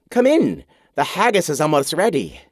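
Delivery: mock Scottish hostess showing off; a woman's bad Scottish accent, played for laughs; awful Scottish accent. A narrator's reading. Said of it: "She resumes her awful accent".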